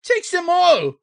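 high, loud frantic cry. Acted. Bewildered man surrendering his rings, frantic high cry. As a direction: cry it out in a panic.

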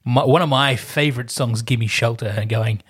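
usual speaking voice

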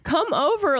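high come-over call; high eager come over to a left-out friend; eager invite. Retold or imitated. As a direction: lift it, inviting her in. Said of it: "And I'm like".

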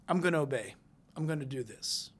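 low, softened resolve; quiet submission. Acted. Believer yielding to God, quiet obedient resolve.

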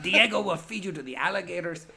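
put-on laughing through a Cuban accent line. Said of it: "Had to do a, a Cuban accent".

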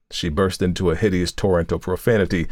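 usual speaking voice

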